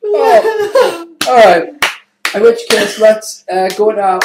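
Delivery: high, loud, squeezed out through laughter; fighting off the giggles; real laughter breaking the words; laughing while talking. Real, not acted.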